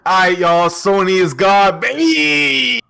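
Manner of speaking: loud, high hyped-up voice; Blaze impression. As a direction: belt it like a hype man. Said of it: "that was my four K impression".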